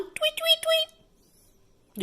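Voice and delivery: high chirpy birdcall tweets; bird tweet. Shown as a demonstration. Teaching a child to make the bird sound, high chirping tweets. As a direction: sing out little bird chirps for the child to copy. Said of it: "Do you know how to make that sound?".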